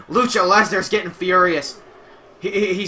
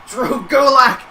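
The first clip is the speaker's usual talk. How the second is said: high, loud, worked-up play-by-play; heated commentator reaction; agitated commentary; 5 semitones higher, 4 dB louder than usual. Real, not acted. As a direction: keep the excitement raised, voice up high. Reacting to surprise attacker.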